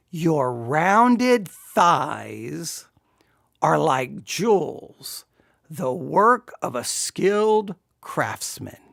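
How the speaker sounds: savoured verse reading. Acted. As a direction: linger teasingly on every word. Reads love poem aloud, savouring it.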